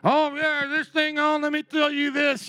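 put-on Southern dumb-guy accent. Pastor mocks a heckler in a Southern accent. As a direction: play the know-it-all with a mild Southern accent. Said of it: "they gotta be Southern in my mind".

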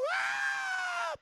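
high, loud war cry; battle shout. Shown as a demonstration. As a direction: let out a piercing battle yell. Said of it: "We're gonna shout really loud".

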